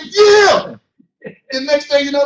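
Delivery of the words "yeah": high, loud cry of "Yeah"; excited church shout. Retold or imitated. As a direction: shout out, swept up in the service. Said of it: "And you be like".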